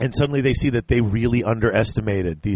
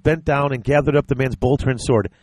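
normal talking voice

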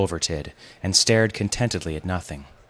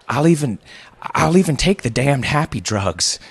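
higher, louder desperate pleading; trembling plea; 7 semitones higher, 4 dB louder than usual. A narrator's reading. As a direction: beg desperately, stumbling and restarting. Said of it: "his voice trembling".

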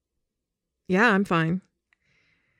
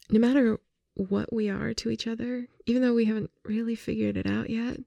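gently admitting you missed them; making up. Acted.

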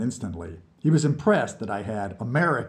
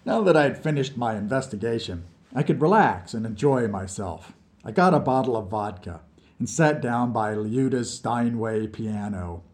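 usual speaking voice

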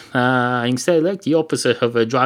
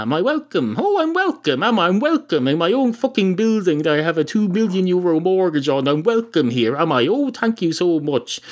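higher-pitched, sarcastic protest; mock indignation; 7 semitones higher than usual. Barman retorts to the cat about his own building, mock indignant. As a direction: play the affronted barman, voice pitched up. Barman takes offence at "You're welcome.

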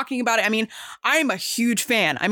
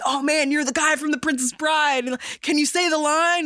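higher, gushing fan voice; excited fan; 6 semitones higher than usual. Retold or imitated. Fan meeting the actor from The Princess Bride, mock gushing. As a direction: imitate a starstruck fan asking a favour. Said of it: "people coming up to me and saying".

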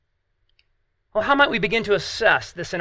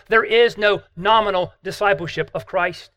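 normal talking voice

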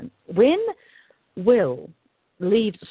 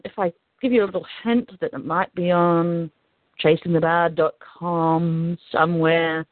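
ordinary delivery

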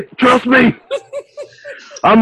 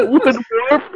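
ordinary talk

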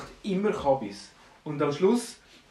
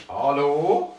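loud, rising call; 6 dB louder than usual. Acted. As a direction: call to someone who isn't listening. Dwarf calls to the busy gnome.

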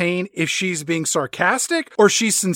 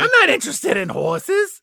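high mock Stacy; 10 semitones higher than usual. Voicing Stacy's reply.

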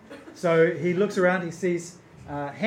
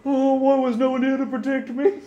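higher, fast muffled plea from inside; 8 semitones higher, 3 dB louder, faster than usual. Acted. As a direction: whine it as if swallowed. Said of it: "a muffled voice".

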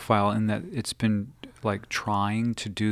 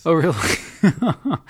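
laughing; 7 semitones higher, 6 dB louder, much slower than usual. Real, not acted. Laughing at Venice sweater joke.

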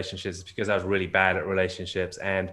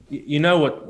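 counselor quoted with a raised, louder voice; voicing the blunt counselor, pitched up; retold counselor; 7 semitones higher, 5 dB louder than usual.

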